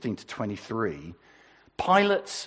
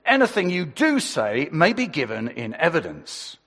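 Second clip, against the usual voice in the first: louder, formal police caution; officer cautioning the arrested man, louder and formal; 4 semitones higher, 8 dB louder than usual. Acted. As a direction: recite it like a copper making an arrest. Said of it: "I've got my best police voice".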